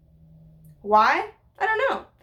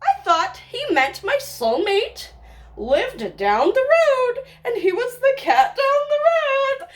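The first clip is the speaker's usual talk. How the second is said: rising higher and louder, slowing; building excitement; 9 semitones higher, 3 dB louder, slower than usual. Real, not acted. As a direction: build to the big reveal. Excited revelation of meaning.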